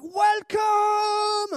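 huge high shrieked greeting; hammed-up, sky-high Welsh lady's cry; enthusiastic older Welsh lady. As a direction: belt out an over-eager welcome. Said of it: "very enthusiastic, enthusiastic older Welsh lady".

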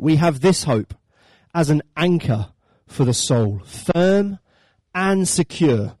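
loud, measured Bible reading with pauses; proclaimed scripture, firm and deliberate; emphatic verse reading. Acted. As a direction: let each phrase land on the congregation. Reads the Bible verse aloud.

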